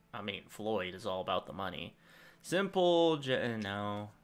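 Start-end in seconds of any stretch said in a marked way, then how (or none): none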